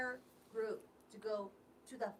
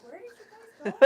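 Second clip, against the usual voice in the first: louder, higher cry of disbelief; mock exasperated shout; 8 semitones higher, 18 dB louder than usual. Retelling the students' pharmacy trip, a loud mock shout. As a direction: belt it out like you can't believe them. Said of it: "And I'm like".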